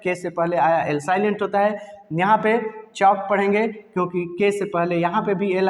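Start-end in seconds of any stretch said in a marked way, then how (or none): 2.9-3.2 चॉक with no L before K; silent L. Shown as a demonstration. Teacher explaining the rule, चॉक with silent L. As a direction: say चॉक leaving L out. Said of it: "इसलिए silent रहेगा".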